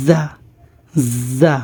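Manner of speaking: জা opened with a long z buzz; জা with the z drawn out. Shown as a demonstration. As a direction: demonstrate জা as Arabic "za", holding the z. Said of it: "জাজের একটা আওয়াজ হবে".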